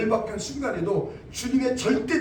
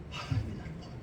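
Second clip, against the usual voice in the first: breathless whisper of despair; whispered lament; 2 semitones lower, 11 dB quieter, faster than usual. Retold or imitated. Preacher retelling muttering before his brother, in a whisper. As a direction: whisper it as if to yourself. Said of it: "나도 모르게 그 말을 듣는 거야".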